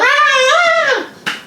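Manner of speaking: imitating fans screaming at a gig, very high; a shrill, piercing fan scream; screaming crowd. Mimicking strangers in the crowd, screaming high. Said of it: "they're like".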